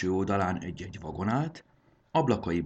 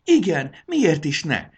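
much higher, louder eager cry; eager shout; 11 semitones higher, 10 dB louder, slower than usual. Acted. Dragon girl cries out she wants the job, eager. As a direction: shout your yes with eagerness. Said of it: "kiáltotta a sárkánylány".

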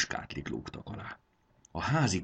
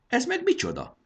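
much higher wondering question; curious surprise; 4 dB louder than usual. Acted. Dragon girl wonders at the shiny whistle, curious. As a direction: wonder aloud at the object.

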